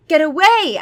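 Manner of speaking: loud high plea; pleading yell. Acted. Freddy begging the vampire off, loud plea. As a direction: beg them off loudly. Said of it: "I pleaded".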